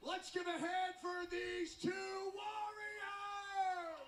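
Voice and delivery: slow booming ring announcer call; boxing ring announcer. Acted. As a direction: call it out big to the arena. Ring announcer hypes the crowd.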